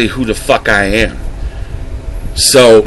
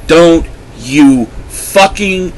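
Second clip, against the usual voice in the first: ordinary talk, nothing marked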